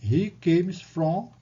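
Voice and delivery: slow, clear model English answer. Shown as a demonstration. Teacher modelling the answer slowly for students. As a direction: say it slowly for the class. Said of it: "você diz".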